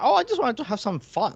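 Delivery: a deliberately poor stab at Irish; bad Irish accent. Co-host mocking Dolph's Irish voice, bad Irish accent. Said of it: "I can't do an Irish accent".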